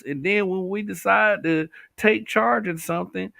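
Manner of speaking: usual speaking voice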